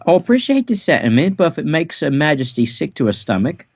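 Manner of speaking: high Cockney maid voice; prim Cockney servant answering politely. Acted. Maid declining Sanji's lunch in high Cockney. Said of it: "so she says".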